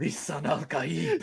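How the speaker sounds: slow, reverent prophetic chant; Stilgar. Retold or imitated. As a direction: declare it with awe, slowly. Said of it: "you're Stilgar".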